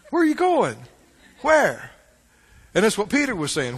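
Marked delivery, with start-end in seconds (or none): 0.1-1.9 higher-pitched, acted-out questioning; insistent questioning. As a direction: keep asking, wanting an answer. Disciples anxiously questioning Jesus, acted.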